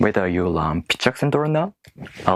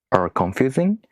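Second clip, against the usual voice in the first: ordinary talk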